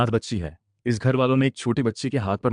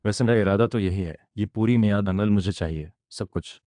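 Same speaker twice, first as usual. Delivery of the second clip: usual speaking voice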